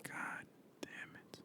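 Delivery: whispered curse under the breath, slow. Real, not acted. Host frustrated with his pinky, whispering a curse. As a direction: hiss a frustrated God damn it to yourself.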